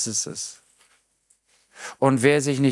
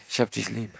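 soft blurted love; 4 semitones lower, 7 dB quieter than usual. Pretend. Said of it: "wann platzt das aus mir".

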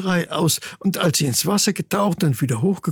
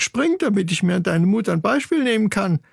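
higher, goading chick voice; daring challenge; 6 semitones higher than usual. Acted. Sister chick dares her brother to show courage, goading. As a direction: egg the braggart on.

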